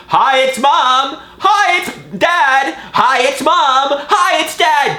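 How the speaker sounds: high mocking parent voices; mocking parents' voicemails. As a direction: parrot the endless mom and dad greetings. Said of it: "forty messages of".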